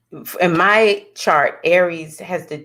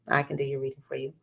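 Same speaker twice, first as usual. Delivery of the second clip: quick, much softer repeat; quiet aside; 4 semitones lower, 12 dB quieter, much faster than usual. Real, not acted. Astrologer offering viewers a reading, quiet aside. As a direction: toss it off low and fast.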